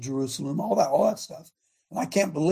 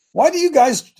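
accusing scribe; 9 semitones higher, 10 dB louder than usual. Acted. Scribes challenge Jesus, acted quote.

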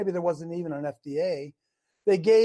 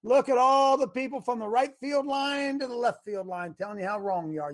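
normal talking voice

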